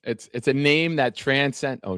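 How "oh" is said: ordinary talk, nothing marked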